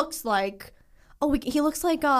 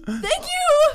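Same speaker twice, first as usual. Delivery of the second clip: much higher, louder chirp; squeaky thank you; 6 dB louder than usual. Retold or imitated. Thanking the kid after the blunder, sheepish squeak. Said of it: "I was like".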